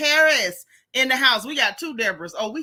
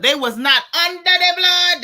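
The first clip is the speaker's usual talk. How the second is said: louder and higher, hammering each word; raised preacher-style emphasis; loud emphatic repeat; 6 semitones higher, 8 dB louder than usual. Real, not acted. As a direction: declare it like a verdict to the chat. Emphatic repeat of chat comment.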